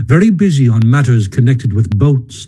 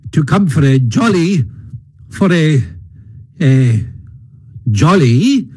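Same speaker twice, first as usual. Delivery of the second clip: faltering heartiness; 3 semitones higher than usual. A narrator's reading. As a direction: cheer fading into halting fragments. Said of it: "His hearty accents faltered and fell away".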